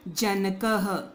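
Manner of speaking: जनकः ending in a breathy ह; visarga h sound. Shown as a demonstration. Teacher demonstrating the visarga in जनकः. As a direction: close जनकः with an h. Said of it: "ह पढ़ना है".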